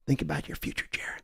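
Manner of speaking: breathy whisper of persuasion; a sly whisper down the phone. Acted. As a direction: lean in and whisper it. Owner leaning in on a deal.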